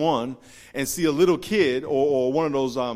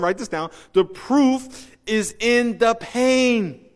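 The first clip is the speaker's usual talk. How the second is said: raised, punchy pulpit delivery; emphatic preaching; 8 semitones higher, 8 dB louder than usual. Real, not acted. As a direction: drive it home to the congregation, stretching the key words. Preaches with rising force.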